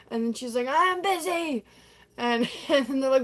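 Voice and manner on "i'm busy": high mock-flustered retort; hammed-up brush-off; flustered refusal. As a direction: blurt it out, pitched up. Donna brushes off her friends.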